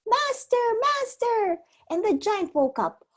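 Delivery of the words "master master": high calling cry; crying harp. A narrator's reading. Harp calling the giant for help, high cry. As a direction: cry out for the master. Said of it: "the harp cried".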